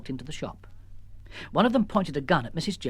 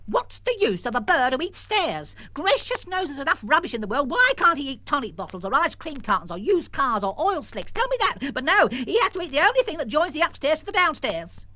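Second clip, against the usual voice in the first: indignant rant; 11 semitones higher than usual. Acted. Missus Jones ranting to Arabelle about the bird, exasperated. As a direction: rant like a fed-up mother.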